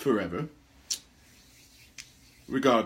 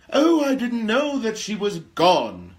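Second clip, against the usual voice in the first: much higher, louder, slow mock-innocent whine; mocking quote; 11 semitones higher, 10 dB louder than usual. Host mocking the Prince of Chicago's excuse, in a sarcastic whine. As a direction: sneer at the careless prince in his own words.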